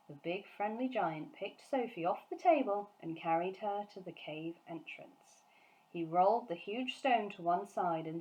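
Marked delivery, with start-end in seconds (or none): none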